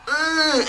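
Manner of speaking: high, drawn-out imitated grunt of outrage; mock indignant huff. Drunk dude before storming off, mock huffy grunt. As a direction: huff high like a sore loser.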